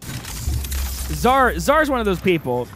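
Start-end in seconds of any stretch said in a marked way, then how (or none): none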